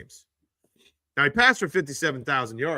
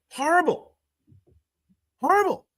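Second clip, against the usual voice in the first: much higher, disgusted verdict; exasperated disgust; 13 semitones higher than usual. Real, not acted. Streamer judging a quarterback's rating, exasperated and high. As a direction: spit the word out in disbelief. Outraged at stats.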